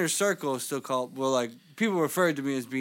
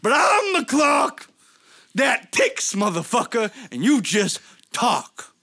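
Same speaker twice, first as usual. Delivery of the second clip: shouted high Southern wrestler promo; wrestling promo; 8 semitones higher, 4 dB louder than usual. Acted. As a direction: roar it like a Southern pro wrestler. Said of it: "I love how [name] turned Southern".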